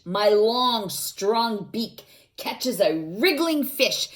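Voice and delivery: put-on dramatic read-aloud for kids; energetic picture book narration; reading to children. Reading the heron page.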